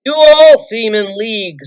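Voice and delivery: loud, bitter accusation; king snarling at wizard and hobbit; angry accusation. A narrator's reading. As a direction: spit out the suspicion.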